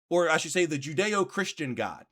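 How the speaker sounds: ordinary talk, nothing marked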